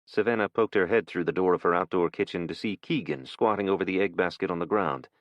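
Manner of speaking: ordinary delivery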